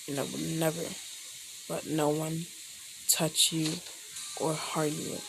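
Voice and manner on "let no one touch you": plain reading voice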